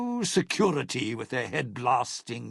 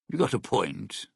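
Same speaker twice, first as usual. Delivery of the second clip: ordinary talk, nothing marked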